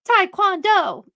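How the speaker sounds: high, loud little announcement; toddler announcing. Retold or imitated. Toddler declaring his favourite sport, high and loud. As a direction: call it out proudly like a tiny kid. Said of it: "And he'll go".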